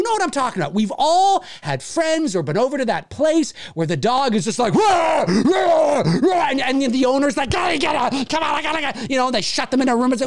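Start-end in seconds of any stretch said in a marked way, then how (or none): 4.7-6.5 loud mock barking rah; dog barking. Dog barking at visitors, loud bark. 7.5-9.1 flustered owner. Retold or imitated. Owner wrangling dog.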